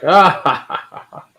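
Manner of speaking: big open "ah" laugh; real laugh. Real, not acted. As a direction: crack up out loud at a joke. Laughing at a chat joke.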